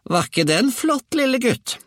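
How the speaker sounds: put-on very high, loud old-woman screech; grandmother's shout. A narrator's reading. Grandmother calls down to boy from the roof, high shout. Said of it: "ropte hun".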